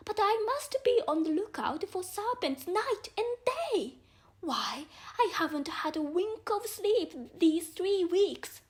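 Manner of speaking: a put-upon bird grumbling about serpents; lightly voiced anxious pigeon; fretful pigeon. A narrator's reading. Pigeon's complaint in read story.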